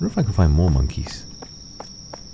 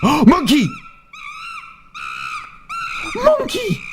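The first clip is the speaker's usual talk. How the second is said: excited shout; slower than usual. Real, not acted. Another monkey encounter.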